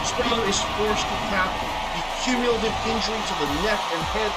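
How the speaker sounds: loud, high finishing call; play-by-play. Acted. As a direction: shout the finish like a ringside caller.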